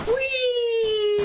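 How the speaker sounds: high gleeful whoop; gleeful high-pitched whee. Real, not acted. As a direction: squeal it out like on a slide. Whee on a jump pad.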